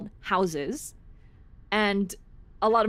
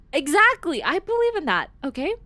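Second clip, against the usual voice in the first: emphatic agreement; 9 semitones higher, 4 dB louder than usual. Real, not acted. Agrees emphatically with a chat comment.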